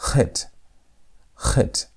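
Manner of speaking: "chet" begun between K and H; guttural CH. Shown as a demonstration. Teacher repeats the sound for practice, guttural CH. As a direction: start "chet" with friction in the throat. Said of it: "Right in between the K and the H sound".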